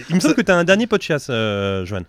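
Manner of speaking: ordinary delivery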